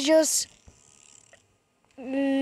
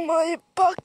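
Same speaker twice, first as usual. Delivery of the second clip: mock shout; 2 semitones higher, 5 dB louder than usual. Silly outburst.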